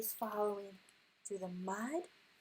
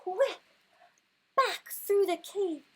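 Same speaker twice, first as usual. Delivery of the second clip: much higher and louder, panicked; urgent cry; 9 semitones higher, 10 dB louder than usual. Acted. Reading the escape to her son, urgent loud cry. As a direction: shout it like you're fleeing. Fleeing the bear.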